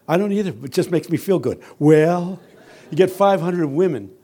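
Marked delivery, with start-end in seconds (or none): 1.8-2.4 imitated rising, louder approving call; lightly voiced church woman responding; church women's "Well". Women in church answering the preacher, louder imitated call. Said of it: "they go like this".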